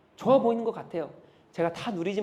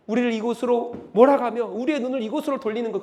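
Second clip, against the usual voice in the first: ordinary delivery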